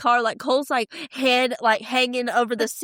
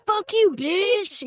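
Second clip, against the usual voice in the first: mock drunk snarl; 8 semitones higher, much slower than usual. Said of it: "she's like".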